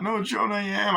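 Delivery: high, insistent protest; hammed-up high-pitched upset; mock distress. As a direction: imitate a distraught caller insisting. Jennifer insisting on the phone.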